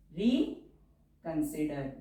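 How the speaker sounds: drawn out word for writing; slow dictation. Shown as a demonstration. Teacher dictating a word to shorthand students, slowly.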